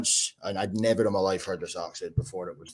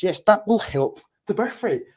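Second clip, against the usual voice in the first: sarcastic; 9 semitones higher, 3 dB louder than usual. Acted. Sarcastic retort.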